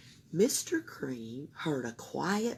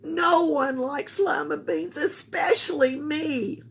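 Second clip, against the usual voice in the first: put-on child voice; 9 semitones higher, 4 dB louder than usual. A narrator's reading. Camilla voiced.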